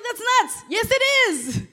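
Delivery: high, loud burst of preaching; loud, high-pitched retort; emphatic exclamation. Acted. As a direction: fire it out loudly to the crowd. Acted objection and emphatic reply.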